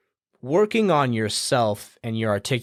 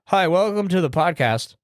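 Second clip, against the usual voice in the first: awkward host greeting; 7 semitones higher than usual. Retold or imitated. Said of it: "There's nothing weirder than".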